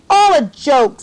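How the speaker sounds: loud, high jeering shout; sneering schoolboy yelling; mocking boy's shout. A narrator's reading. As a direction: bark it at her like a bully. Boy mocks Margot.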